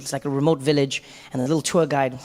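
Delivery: ordinary talk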